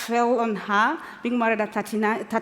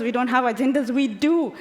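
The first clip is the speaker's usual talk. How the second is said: ordinary talk, nothing marked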